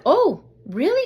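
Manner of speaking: high, loud, taken aback; surprised stranger. Retold or imitated. Stranger learning the dog bites, high loud surprise. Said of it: "she's like".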